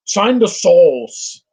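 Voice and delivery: very slow, exaggerated imitation of a British accent; mock British accent. Mimicking drunk-sounding British actors, mock British accent. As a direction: stretch each word in a mocking British accent. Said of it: "they say things wrong".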